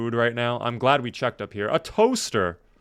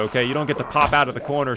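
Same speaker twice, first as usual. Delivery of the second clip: normal talking voice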